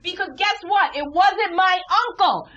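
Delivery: high, loud indignant telling; shouted punchline. Retold or imitated. Telling the audience the twist, high and loud. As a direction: belt the reveal to the crowd.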